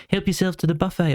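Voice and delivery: lightly voiced hostess offering food; a hint of a kind mother; friendly mum. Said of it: "she went".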